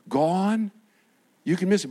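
ordinary delivery

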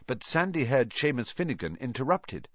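ordinary delivery

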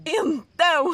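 high, loud playful yelp; excited squeal. Pretend. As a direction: squeal it out teasingly.

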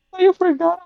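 Chelsea laughing. Retold or imitated. Chelsea voiced while laughing.

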